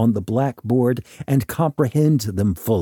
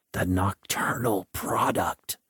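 whispered hollow voice; hollow ghostly whisper; 4 semitones lower, 6 dB quieter than usual. Retold or imitated. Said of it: "reminding me in a hollow voice".

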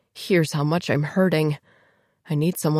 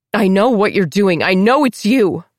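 accusing; 4 semitones higher, 6 dB louder than usual. Acted. Narrator accuses Diana aloud.

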